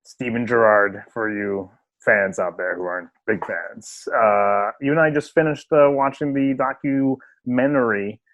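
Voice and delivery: normal talking voice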